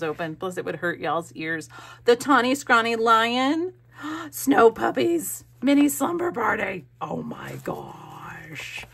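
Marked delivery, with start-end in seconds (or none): 2.1-6.9 high, loud, thrilled title-reading; excited exclaiming. Real, not acted. Vlogger reads out titles of gifted books, excited exclaiming. 7.0-8.8 slow, hushed disbelief; overwhelmed whisper. Real, not acted. Vlogger overwhelmed by gifted books, hushed whisper.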